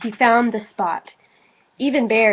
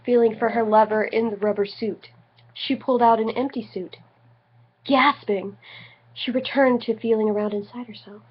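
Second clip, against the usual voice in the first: ordinary delivery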